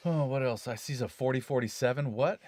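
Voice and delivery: ordinary talk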